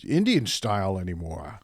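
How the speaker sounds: slow, drawn-out stand-up comic impression; George Carlin. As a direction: deliver it as a slow comedy routine. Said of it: "What does Carlin sound like?".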